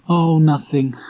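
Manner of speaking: put-on high, halting boyish voice; hesitant boy. A narrator's reading. Christopher Robin trying to tell Pooh, high halting voice. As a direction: stumble over the words like a shy child.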